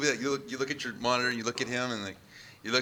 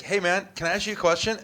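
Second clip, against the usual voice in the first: a security guy butting in, voiced higher and louder; mock eager guard; 8 semitones higher, 5 dB louder than usual. Videographer mocking the guard who interrupts, eager and higher. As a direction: play the guy who pops up the second you hit record.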